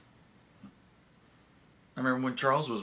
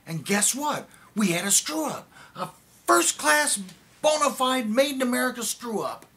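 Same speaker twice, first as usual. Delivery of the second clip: loud, high, building bitter sarcasm; furious outburst; 12 semitones higher, 8 dB louder than usual. Acted. Admitting the program's failure to his friend, furious. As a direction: climb to a shout, stretching the proud adjectives.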